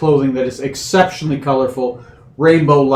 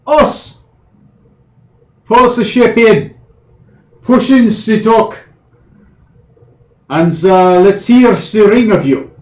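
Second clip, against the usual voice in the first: high, loud bossy hobgoblin barking orders; pitched-up dock boss shouting across the pier; hobgoblin dock master; 7 semitones higher, 6 dB louder than usual. Acted. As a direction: call the crew in as a brash hobgoblin.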